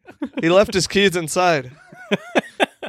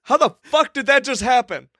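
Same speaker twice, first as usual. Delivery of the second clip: high-pitched, stunned outburst; yelling; 8 semitones higher than usual. Real, not acted. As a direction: cry out in sheer disbelief. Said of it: "What are you yelling about?".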